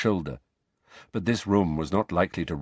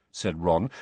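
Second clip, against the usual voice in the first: ordinary delivery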